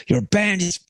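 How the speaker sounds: high-pitched put-on praise turning to insult; imitated yelling Belgian. Angry fan telling musician he loves the band, imitated rant. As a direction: pitch it up, praising and scolding at once. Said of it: "he was just like".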